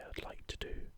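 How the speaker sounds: usual speaking voice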